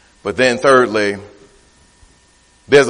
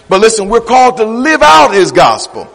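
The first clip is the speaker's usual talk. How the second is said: raised, driving preacher's delivery; building sermon fervour; 8 semitones higher, 5 dB louder than usual. Acted. Preacher urging the church to display God, rising fervent preaching. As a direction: build momentum clause by clause without letting up.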